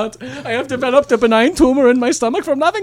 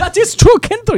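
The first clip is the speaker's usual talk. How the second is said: excited; 10 semitones higher, 7 dB louder than usual. Acted. Excited German agreement.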